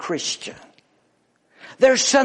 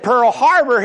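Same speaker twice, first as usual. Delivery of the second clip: higher, louder puzzled echo of "Pearl Harbor"; baffled repeat; 7 semitones higher, 8 dB louder than usual. Acted. Joke, Chinese man baffled.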